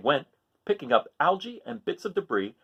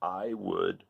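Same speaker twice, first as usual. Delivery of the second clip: lower, softer creaky reply; creaking coral; 6 semitones lower, 5 dB quieter than usual. Acted. Crusty coral answers Hermit Crab, low and soft. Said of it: "creaked the crusty coral".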